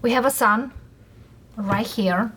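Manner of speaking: ordinary talk, nothing marked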